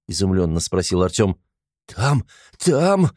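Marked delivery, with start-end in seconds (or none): 1.9-3.1 a high, bleating little cry; bleating whine of a frightened little man. A narrator's reading. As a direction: bleat it out like a scared goat. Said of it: "заблеял коротышка".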